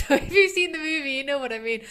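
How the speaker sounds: laughing. Real, not acted. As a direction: laugh through the words at a funny thought. Laughs recalling Shrek.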